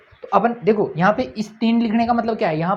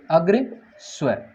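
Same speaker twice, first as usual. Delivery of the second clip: slow dictation; much slower than usual. Shown as a demonstration. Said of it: "teacher repeats term slowly".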